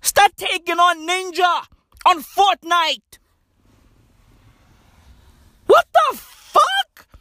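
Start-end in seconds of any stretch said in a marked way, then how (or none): 5.6-7.1 high, slow, stretched-out disbelief; outraged disbelief. Acted. Comic reacting to complaining doctors, high incredulous. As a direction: let it ring out, incredulous.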